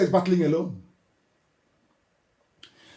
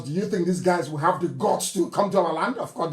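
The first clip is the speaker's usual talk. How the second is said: ordinary talk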